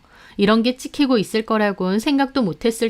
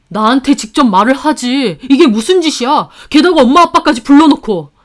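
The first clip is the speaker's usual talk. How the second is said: louder, angry complaint; husband angry at being exposed; angry protest; 4 semitones higher, 9 dB louder than usual. A narrator's reading. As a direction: snap back at the wife. Husband confronts wife angrily.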